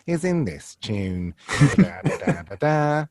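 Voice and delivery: sing-song deadpan tune going up; Jo Brand. Shown as a demonstration. Impressionist shows her speech tune, sing-song impression. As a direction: lift the phrase in a repeating comic melody. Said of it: "when you're doing Jo Brand".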